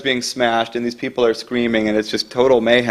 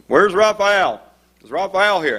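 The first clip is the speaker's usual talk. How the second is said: higher-pitched barked questions; imitated bark; 7 semitones higher than usual. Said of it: "barking out".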